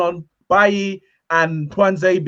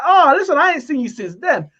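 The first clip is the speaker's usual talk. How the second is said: higher, playful mock greeting; teasing his brother; 6 semitones higher than usual. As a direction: rub it in like a cheeky greeting. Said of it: "So I was like".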